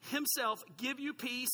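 slow, weighty reading of the verse; scripture reading. Acted. Pastor reading Paul's blessing to the congregation, slow and solemn. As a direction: read it out slowly, like holy words.

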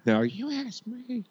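very high, slow, soft character voice; put-on sketch character. Superfan wanting his turn, high put-on voice. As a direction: squeeze out a high, slow comic voice.

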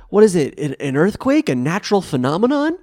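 high, loud imitation of a baffled character; mock alarmed questioning. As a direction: ask it in hammed-up disbelief. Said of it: "fucking somebody's like".

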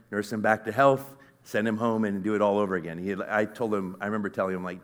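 ordinary talk, nothing marked

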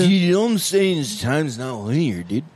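put-on slurred, slow drunk talk. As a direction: slur it like you've had a few. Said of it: "That was a real drunk voice".

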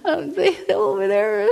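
high, wailing mock sob; exaggerated blubbering, words dissolving into a whine; mock crying. Stranded driver telling a stranger her address, mock bawling. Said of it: "I'm just crying".

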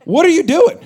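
high, loud disbelief; shocked dad. Retold or imitated. Dad shocked as ball hits his son, loud. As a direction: cry out at the ball hitting him. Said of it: "I'm like".

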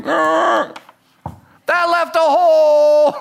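mock pained wail; drawn-out lament. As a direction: stretch it out like it hurts. Acting pained realization.

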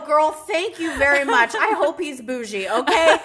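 loud, laughing, sassy reply; loud sassy retort to her friend about the toilet. Retold or imitated. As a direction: scold her friend with big laughing sass. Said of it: "I go".

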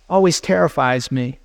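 ordinary talk

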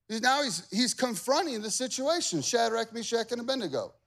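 ordinary talk, nothing marked

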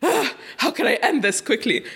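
laughing, flustered inner panic; mock panic. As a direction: fumble for an exit, half laughing. Nervous inner thought.

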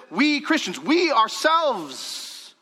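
"we" high and stretched out; emphatic WE. Shown as a demonstration. Preacher sets Christians apart, heavy stress on "we". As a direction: lean long and hard on each "we". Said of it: "He's emphasizing that word".

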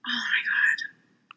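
exasperated breathy whisper under the breath; furious whisper. Real, not acted. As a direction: whisper it in frustration. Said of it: "I'm, like, furious right now".

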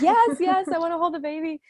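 high, eager yes. Retold or imitated. New mother answers her husband about holding the baby, high and eager. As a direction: grab the chance, high and eager. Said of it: "I'm like".

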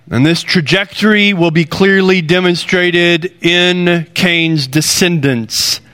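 ordinary delivery

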